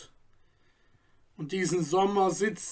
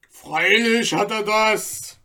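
louder interjection from the crowd; calling in; 3 semitones higher, 5 dB louder than usual. A narrator's reading. Third bystander calls into the talk, louder. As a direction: cut in with a shout. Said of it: "rief ein Dritter dazwischen".

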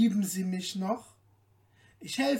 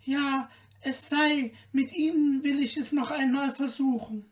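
put-on lightly raised, gently pleading girl's voice; young daughter's voice; 7 semitones higher, 3 dB louder than usual. Märchen resolves to try again.

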